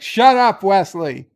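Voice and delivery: loud, high-pitched scolding; hammed-up fed-up mother shouting him down; exasperated outburst. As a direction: cut the kid off with a sudden yell. Mother cuts off her pleading son.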